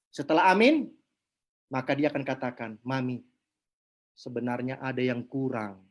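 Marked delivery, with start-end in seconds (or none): 2.8-5.8 pelan dan rendah, marah yang terkendali; lembut. Acted. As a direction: menegur istri dengan suara rendah lembut. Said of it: "ngomongnya sudah lembut". (lembut, pelan, rendah)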